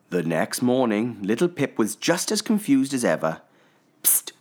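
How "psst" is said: unvoiced hushed psst; whispered psst. A narrator's reading. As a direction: hiss a quiet psst to wake him. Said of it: "said Little Pip".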